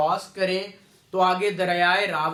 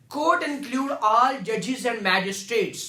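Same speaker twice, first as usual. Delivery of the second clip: slow reading; much slower than usual. Shown as a demonstration. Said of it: "reading the statute slowly".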